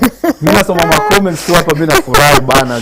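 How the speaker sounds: loud, high-pitched mock indignation; playful protest. Real, not acted. Host teased by co-host for zoning out, playfully protesting. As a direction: protest loudly and good-humouredly.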